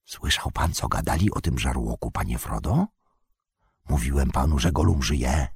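very low, soft hushed voice; whispering. Acted. Sam asking Frodo about Gollum, in a whisper. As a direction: whisper it so nobody nearby hears. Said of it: "ale już szeptem".

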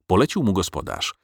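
ordinary delivery, nothing marked

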